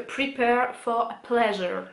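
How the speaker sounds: slow, careful English with a French accent; English shade name, drawn out with French vowels. Real, not acted. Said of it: "excusez mon accent anglais merveilleux".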